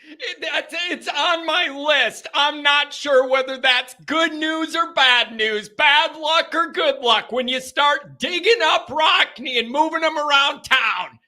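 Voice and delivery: hammed-up high voice; high-pitched comic incredulity. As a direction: riff in mock disbelief. Keeps riffing on moving Rockne's casket.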